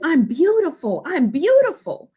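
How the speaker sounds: high, rising cry of joy; an overjoyed woman; joyful exclaim. Retold or imitated. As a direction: proclaim it to the whole car.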